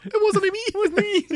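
very high squeaky denial; mock protest. Player denying the noise to the table, squeaky mock protest. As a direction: plead innocence in a comic high squeak.

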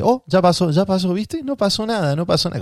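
mucho más aguda, como a un nene; hablándole a un niño. Retold or imitated. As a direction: consolar como a un chico de cinco años. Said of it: "como si tuviera cinco años yo". (enfermera hablándole como a un nene, voz muy aguda)

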